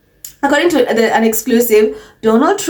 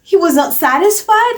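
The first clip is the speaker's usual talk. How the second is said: mock disbelief; 9 semitones higher than usual. Mocking Kate's incredulous reaction.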